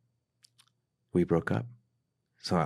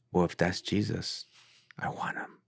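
softly retold, fading to a whisper; pause, then barely breathe the last words; hushed surrender; 6 dB quieter than usual. Retells her moment of surrender.

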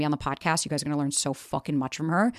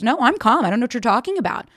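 imitating forced calm, higher and louder; mock insistent calm; 6 semitones higher, 5 dB louder than usual. Sister imitating brother's denial of dysregulation, mock insistent calm. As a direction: protest your calm in a raised, mocking voice. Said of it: "He does the control by staying calm".

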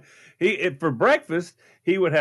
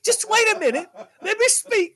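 insistent protest; 11 semitones higher than usual. Real, not acted. Insists on speaking over host.

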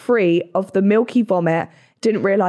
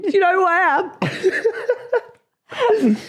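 high, laughing diva line; laughing mock diva; 10 semitones higher, slower than usual. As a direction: laugh through a posh outrage. Mock entitled outburst.

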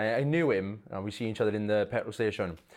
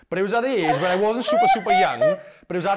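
laughing; 8 semitones higher, 8 dB louder than usual. Real, not acted. Laughing while telling.

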